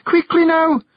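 high, loud, urgent Scots call; shout. A narrator's reading. Jeanie calls down the hole to the clan, urgent shout. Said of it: "Jeanie shouted down the hole".

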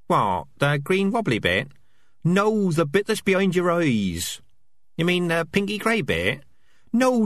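squeaky rat chatter. Acted. Rats voiced in dialogue.

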